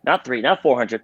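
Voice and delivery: ordinary talk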